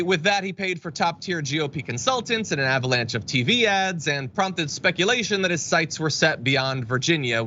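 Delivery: normal talking voice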